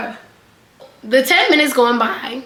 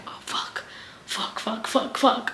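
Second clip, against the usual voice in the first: imitated panic, cursing under the breath; hushed freak-out, retold; panicked whisper; 13 dB quieter, slower than usual. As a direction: whisper a string of mock-panicked swears. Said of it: "he starts freaking the fuck out".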